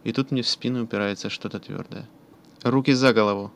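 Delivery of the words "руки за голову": cold, steely command, louder; calm metallic voice. A narrator's reading. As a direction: order it flat and metallic. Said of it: "произносит спокойный металлический голос".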